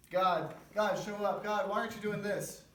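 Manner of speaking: high, loud, demanding call to God; impatient prayer. Pretend. A man who gives God one minute, calling out high and loud in impatience. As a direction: insist that God show up right now.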